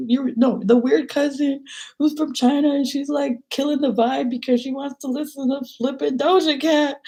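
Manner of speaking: high, whiny self-pitying rant; upset whining. Retold or imitated. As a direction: imitate your drunk, upset self venting to a cousin. Retelling upset rant to cousin.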